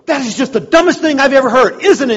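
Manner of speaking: hammed-up disdainful customer; scornful appeal. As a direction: play her fishing for agreement, scoffing. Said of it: "She turns to him and goes".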